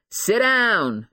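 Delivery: detective losing patience, voice up; angry order. A narrator's reading. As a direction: order her down sharply. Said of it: "Marino orders her angrily".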